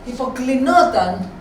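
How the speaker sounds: plain reading voice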